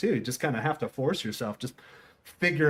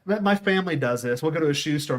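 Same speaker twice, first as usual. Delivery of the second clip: ordinary talk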